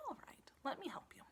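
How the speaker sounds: whispered gentle reply; soft whisper. Acted. Wife whispering her reply to the farmer. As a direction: whisper a kindly yes.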